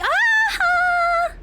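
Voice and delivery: high, loud yelp of fright; startled scream. Real, not acted. Player jumped by something in the game, startled scream. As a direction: scream out in sudden shock.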